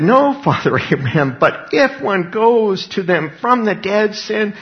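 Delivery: high, slow, drawn-out plea; acted plea. Preacher as the tormented man begging Father Abraham, acted plea.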